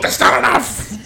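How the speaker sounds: slow high mock wail; imitating outraged customer. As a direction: drag it out as a hammed-up tantrum. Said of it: "and she still goes".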